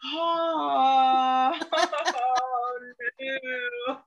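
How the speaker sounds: laughing. Retold or imitated. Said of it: "I was just like".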